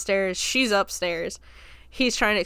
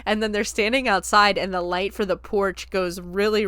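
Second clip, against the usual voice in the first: ordinary talk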